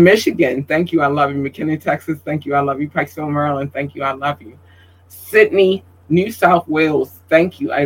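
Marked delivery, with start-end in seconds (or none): none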